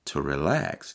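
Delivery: low, soft, soothing "relax"; soft calming relax. Shown as a demonstration. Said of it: "allow me just for a moment to tell you".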